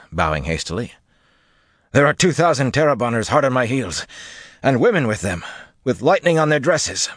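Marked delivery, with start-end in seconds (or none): 1.9-7.1 high-pitched, breathless messenger's warning; panted report. A narrator's reading. Armsman warns his lord of pursuing lancers, panting. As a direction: pant out the alarm, pitch pushed up. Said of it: "the fellow panted".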